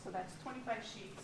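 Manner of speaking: far from the microphone; speaking away from the mic. Real, not acted. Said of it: "please speak into the mic".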